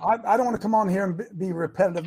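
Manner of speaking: normal talking voice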